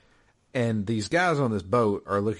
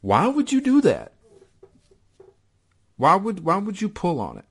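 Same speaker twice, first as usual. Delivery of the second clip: exasperated disbelief; 11 semitones higher, 5 dB louder than usual. Real, not acted. Exasperated at player's blunder.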